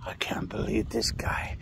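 hushed mocking whisper; whispered mocking quote. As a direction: whisper it behind his back. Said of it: "moaning and saying".

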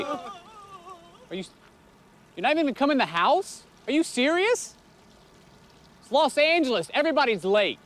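indignant surprise. Acted. Real owner baffled at fleeing crew.

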